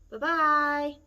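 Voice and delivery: a loud middle bear goodbye; middle-sized bear voice. Acted. Mama Bear calls goodbye to the girl, middle voice. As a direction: call it out in a middle voice. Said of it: "a middle-sized voice".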